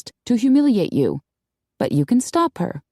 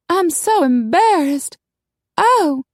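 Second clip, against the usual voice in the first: slow, very high wail; mortified lady; 11 semitones higher, 4 dB louder, slower than usual. A narrator's reading. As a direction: whine with embarrassment, high and drawn out. Coralie embarrassed, tosses fan.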